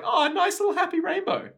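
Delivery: high, fast, sugary mock cheer; mock sweet voice. Mocking the devil's rainbows in a high chirpy voice. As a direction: chirp it like a cartoon.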